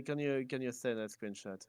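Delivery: usual speaking voice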